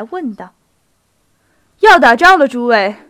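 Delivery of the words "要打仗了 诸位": excited announcement. A narrator's reading. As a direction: call out the big news to everyone. Bursts in with news of war.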